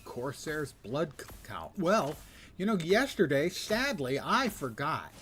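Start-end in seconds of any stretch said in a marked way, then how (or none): 1.8-5.1 loud, high and animated; excited. Real, not acted. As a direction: burst out with big energy. Loud excited confession on stream.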